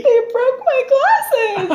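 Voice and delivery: high, slow, whimpering imitation of a crying drunk friend; mock whiny crying. Friend mocking Alex crying about his broken glasses, high whiny sob voice. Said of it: "Except I said it way more manly than that".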